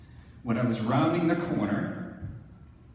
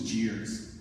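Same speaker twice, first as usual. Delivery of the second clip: ordinary delivery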